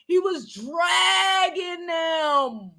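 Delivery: DRAGGING drawn out long and high; high, lingering hold on "dragging"; stretched emphasis. Retold or imitated. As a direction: stretch "dragging" slowly for relish.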